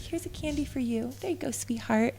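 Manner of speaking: soft sugary patronizing murmur; patronizing sweet talk. Retold or imitated. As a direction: talk down to her like a child. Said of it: "Be like".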